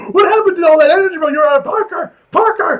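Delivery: high, loud and fast; hyped yelling. Real, not acted. A reader yells loudly, fired up about Peter's weak greeting. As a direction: shout like you can't believe it.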